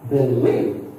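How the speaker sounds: "believe" with LIEVE loud and rising; stress on second syllable. Shown as a demonstration. Teacher listing verbs for learners, second-syllable stress. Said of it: "give stress on the second syllable".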